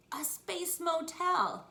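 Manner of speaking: loud, high, stretched-out delight; excited kid's discovery; delighted exclamation. Acted. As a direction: announce it slowly and proudly. Delighted child exclaims in story.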